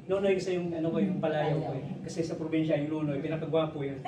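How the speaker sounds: ordinary talk, nothing marked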